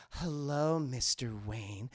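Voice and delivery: low, soft, slinky greeting; mock sultry. Rhonda greeting Bruce Wayne, hammed-up sultry. Seductive woman in skin-tight outfit greets.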